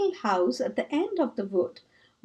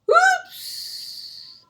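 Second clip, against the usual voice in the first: high, yelped whoops. Acted. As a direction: squeal as the sledge tips. Sledge flies downhill, toppling.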